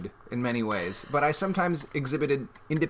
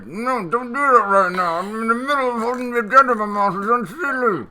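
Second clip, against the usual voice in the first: mock mutter; 8 semitones higher, 9 dB louder than usual. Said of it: "I was actually trying to mutter".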